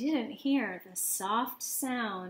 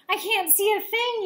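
high, louder panicked yell; 10 semitones higher, 6 dB louder than usual. A narrator's reading. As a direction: shout it blind in the dark. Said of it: "yelled Pip".

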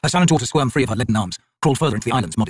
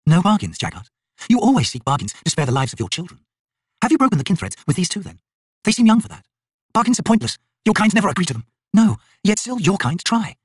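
higher, mocking young woman's voice; a lightly scornful, higher young huntress; young Imas woman voiced high; 8 semitones higher than usual. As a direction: taunt the cornered Jaghut lightly from above. Imas woman addresses the Jaghut.

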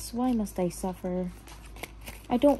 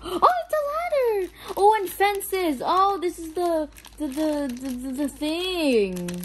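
high, gasping squeal of excitement; gasp, then squeal it out high; excited discovery; 7 semitones higher than usual. Real, not acted. Discovering a ladder and fences, excited squeal.

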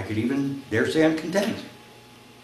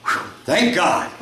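slow, higher, breath blown out then gasped; gasping with relief; 12 semitones higher, slower than usual. Acted. Rider saved at the cliff edge, relieved gasp. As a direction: let out huge relief, gasping for air. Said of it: "Gasping for air, with relief, he says".

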